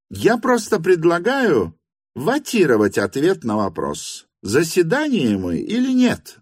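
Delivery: high-pitched, assertive hostess voice; hostess proposing. A narrator's reading. Madam puts the question to the gathering, high and assertive. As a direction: put the motion in a high character voice.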